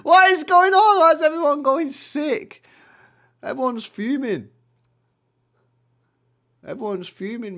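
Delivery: very high, loud laughing outcry; laughing disbelief. Real, not acted. Streamer laughing at furious chat, high and loud.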